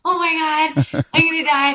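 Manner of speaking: acted-out alarm; mock panic. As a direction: play at dreading the oncoming wave. Acts out panic facing the wave.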